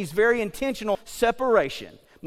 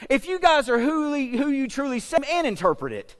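doubting king; 6 semitones higher than usual. Acted. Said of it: "King Nebi looks at his boys and says".

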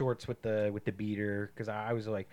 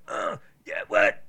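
whispered rasp then a loud bark like [name]; [name] impression; 4 semitones lower, 4 dB louder, slower than usual. Imitating [name] to a friend, rasping then barking. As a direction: growl it like [name]. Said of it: "a D M X thing".

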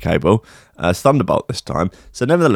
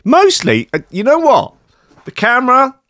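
high voice; 4 dB louder than usual. Pretend. Sudden high mock voice.

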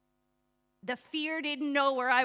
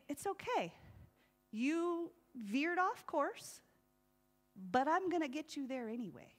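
loving and kind; 2 semitones higher, 5 dB quieter than usual. Pretend. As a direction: gently reassure a lost driver. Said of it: "is loving and kind".

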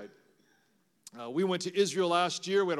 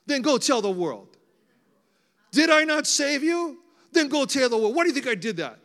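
loud, high, commanding challenge; insistent God's voice; 8 semitones higher, 8 dB louder than usual. Acted. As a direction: boom it out as a forceful divine demand. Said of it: "he keeps telling me, he goes".

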